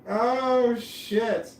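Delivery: sudden loud, high yelp, then settling; real jolt of pain from a Lego, loud and high; startled yelp of pain. Real, not acted. Hurt her finger on Lego.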